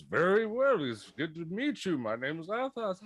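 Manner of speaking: towering Athos voice. Acted. Seven-foot Athos greeting the small satyr, formal. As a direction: greet him politely from above. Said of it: "Athos looks down to Zontan and goes".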